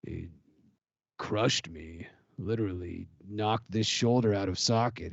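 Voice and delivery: low, ominous warrior voice; ominous fighter voice. Acted. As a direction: brood over it like a grim gladiator. Gladiator character voice.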